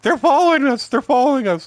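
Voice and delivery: high, loud frantic mock worry; mock paranoid panic. Paranoid buddy in the car fears being followed, high mock panic. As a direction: ham up the friend's paranoid panic. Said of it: "he was worried that the Mexicans were following us".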